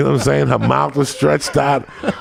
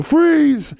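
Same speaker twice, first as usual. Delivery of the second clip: mock robber yell. Inmate bursting in on the cooks, yelling freeze. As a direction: bark it to scare the cooks.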